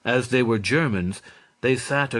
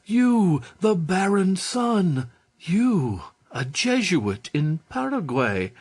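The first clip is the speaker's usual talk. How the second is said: overjoyed reunion; 8 semitones higher than usual. Acted. Tearful reunion with long-lost friend.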